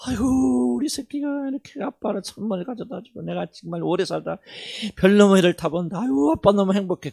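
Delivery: high, loud, gushing delight at a birthday gift; overjoyed dad. Acted. Father thanking his child for a gift, gushing high and loud. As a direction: play a father beside himself with joy. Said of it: "그 아빠가?".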